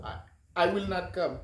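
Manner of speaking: ordinary delivery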